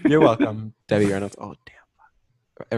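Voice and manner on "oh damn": hushed whisper; whispered oops. Real, not acted. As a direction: breathe it under your breath. Whispered aside.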